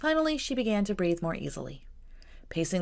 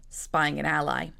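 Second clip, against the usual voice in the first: ordinary delivery, nothing marked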